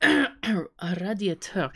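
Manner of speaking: A radiateur, drawn out slowly; slow careful try. Shown as a demonstration. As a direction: sound out A radiateur slowly, thinking. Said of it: "Is that a heater?".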